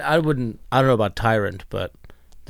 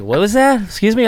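much higher, louder mock indignation; imitating an irritated roommate; 11 semitones higher, 7 dB louder than usual. Guest mimics host on the couch, high loud mock indignation. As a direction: play the stoned guy snapping up off the couch. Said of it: "you're like".

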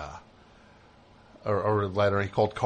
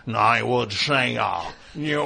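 slow, mumbled hammy Carson voice; bad impression. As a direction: overdo a muddy Carson impression. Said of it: "we can't understand what you're saying".

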